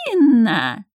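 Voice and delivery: squeaky doll voice. A narrator's reading. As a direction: stretch the name out like a creepy doll. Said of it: "писклявым голосом протянула кукла".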